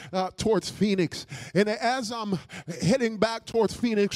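loud, high-pitched preaching; impassioned preaching. Real, not acted. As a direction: preach it with driving force. Preaching at full force.

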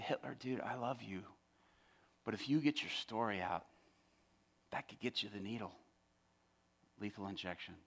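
soft, low, caring caution; tender warning. Retold or imitated. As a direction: gently warn a friend you love. Gently warning inmate he loves.

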